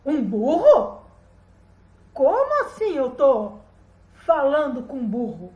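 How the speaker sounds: loud astonished question; incredulous. A narrator's reading. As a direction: stretch out the disbelief. Boy amazed at talking donkey.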